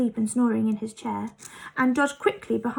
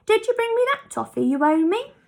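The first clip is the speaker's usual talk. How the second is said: high, louder funny character voice; Moonface's voice; 10 semitones higher, 5 dB louder, faster than usual. A narrator's reading. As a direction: play him eager and cheeky.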